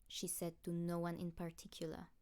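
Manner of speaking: ordinary delivery, nothing marked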